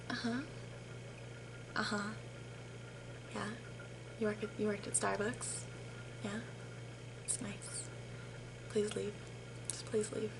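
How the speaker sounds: very quiet, spaced-out muttering; barely audible under-the-breath replies, with long pauses; muttered thoughts. Retold or imitated. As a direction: mutter politely while wishing they would leave. Silently wishing customer would leave.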